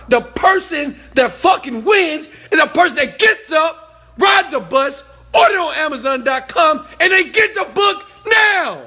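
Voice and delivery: high, full-force motivational shouting; fired-up shout. Real, not acted. Coach telling followers who really wins, fired-up shout. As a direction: hammer each step home at the top of your voice.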